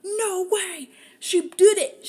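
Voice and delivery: Amarantha's startled mock outburst; mock shocked disbelief. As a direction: shriek it as if you can't believe it. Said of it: "Amarantha's like".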